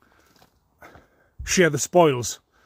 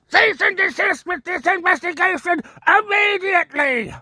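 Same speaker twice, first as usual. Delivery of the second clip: high, pompous parody of an officious demand; mocking falsetto order; mock troll voice. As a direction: squeak it out like a self-important keyboard warrior.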